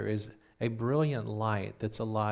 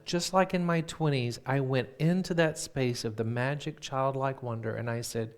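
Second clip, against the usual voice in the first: usual speaking voice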